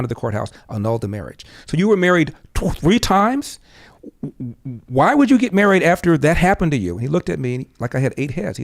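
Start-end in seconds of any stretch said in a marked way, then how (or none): 1.7-6.9 incredulous questioning. Retold or imitated. Son questioning third marriage.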